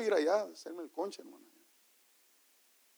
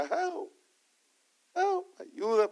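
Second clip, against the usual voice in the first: retold cry of pain; 10 semitones higher, much slower than usual. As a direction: mimic someone hurt calling out, kept small. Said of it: "estaba gritando en dolor".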